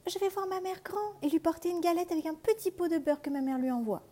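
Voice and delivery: put-on high, light little girl's voice. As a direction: sound like an innocent young girl. Fillette répond au loup. (voix aiguë de petite fille)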